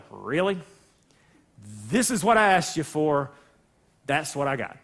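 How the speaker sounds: low, dry, unimpressed God; deadpan disappointment. Retold or imitated. God looking at the busted sheep offering, low and deadpan. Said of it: "And God's going".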